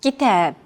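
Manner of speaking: Kitab with a longer second syllable, -TAB; short Ki, stressed long tab in Kitab. Shown as a demonstration. Teacher showing Arabic stress timing, stressing second syllable. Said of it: "second syllable is stressed".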